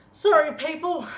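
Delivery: loud sheepish apology. Real, not acted. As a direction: snap out of the rant with a loud sorry. Apology after rant.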